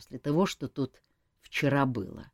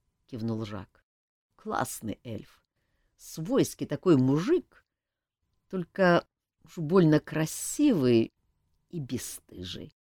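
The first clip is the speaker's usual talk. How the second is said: ordinary delivery, nothing marked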